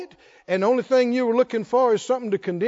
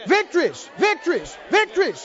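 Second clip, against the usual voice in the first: preaching shout; 8 semitones higher than usual. Real, not acted. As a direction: ring it out like a victory cry. Preacher chanting at congregation.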